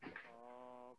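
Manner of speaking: faint, straining to be heard; too soft despite trying; barely audible. Real, not acted. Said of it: "Soft, soft".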